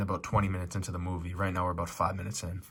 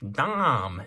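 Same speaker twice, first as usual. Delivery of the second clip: calling out Dom; 5 semitones higher than usual. Retold or imitated. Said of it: "they go".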